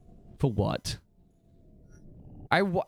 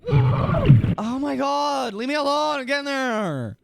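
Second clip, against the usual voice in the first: loud, slow, higher groan of oh my god; exasperated outburst; 8 semitones higher, 5 dB louder than usual. Real, not acted. Exasperated at chat teasing.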